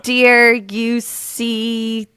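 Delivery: slow, halting word by word; tentative, broken-up reading; sounding it out. Shown as a demonstration. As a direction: puzzle it out, rising to a question at the end. Puzzling out the phonetic sentence.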